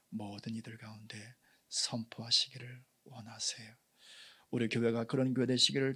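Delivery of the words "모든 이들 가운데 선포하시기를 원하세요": hushed preaching whisper. Acted. Pastor whispering the point to the congregation. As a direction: drop to an intimate whisper.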